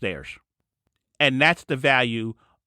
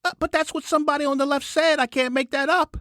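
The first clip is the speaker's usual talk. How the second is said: worked-up, much higher pitch; riled up, voice climbing high; exasperated rant pitched way up; voice too high; 10 semitones higher than usual. Real, not acted. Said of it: "voice been so high this entire show".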